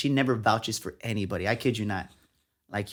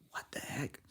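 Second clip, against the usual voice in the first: hushed, voiceless disbelief; stunned whisper; 15 dB quieter than usual. Retold or imitated. Young writer reacting to mentor's praise, stunned whisper.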